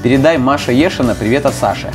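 normal talking voice

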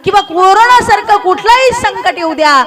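high, ringing exhortation to the congregation; fervent preaching. Acted. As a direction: urge them on with stretched, emphatic key words. Exhorts congregation at full force.

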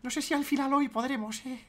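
high whiny voice, complaining of tiredness; mock tired youtuber. Mocking a lazy youtuber's excuses, high whine. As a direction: whine like a drained streamer. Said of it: "A los cansaditos".

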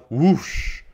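long, loud rushing whoosh; storybook take-off sound, stretched and loud. A narrator's reading. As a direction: draw the word out like a blast of air. Reader acts out the loud whoosh.

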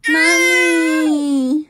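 toddler's crying call for mom, played up; imitating a crying baby. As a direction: cry out mami like a fussing toddler. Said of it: "Pag umiiyak ka, lagi mo sinasabi".

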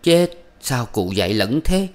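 ordinary delivery, nothing marked

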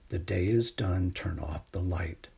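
plain reading voice

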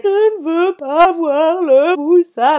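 put-on high, loud, slow whining child voice; imitating a scared toddler; scared little girl. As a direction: whine it like a frightened toddler. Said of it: "elle fait".

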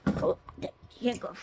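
normal talking voice

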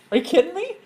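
high incredulous rise on me; stunned, can't believe it; real amazement, pitch leaping up; amazed. Real, not acted. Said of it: "preacher's amazed outburst".

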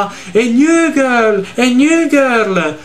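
high, drawn-out excited imitation; Thomas esaltato. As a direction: mimic an overexcited cry, stretching each word. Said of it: "Tutto esaltato". (imitazione esaltata, acuta e strascicata)

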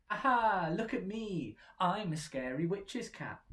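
loud high triumphant cry; excited cry. A narrator's reading. Splat showing off his costume, excited cry. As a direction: show off gleefully. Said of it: "cried Splat".

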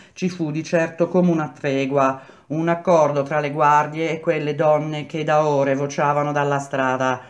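ordinary delivery, nothing marked

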